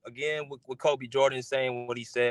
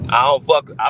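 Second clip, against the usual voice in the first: loud, angry burst; sudden angry shout; 11 dB louder than usual. Real, not acted. Host blasting a guest he calls a liar, loud angry burst. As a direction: bark the first words out in anger.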